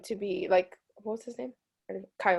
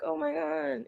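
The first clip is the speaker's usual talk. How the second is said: mock gasp; 8 semitones higher, 6 dB quieter than usual. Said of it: "they're like".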